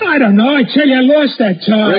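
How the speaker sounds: high, loud, pleading protest; cornered man yelling he lost it; desperate holler. Acted. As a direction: shout your innocence at them. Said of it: "No matter how loud you holler".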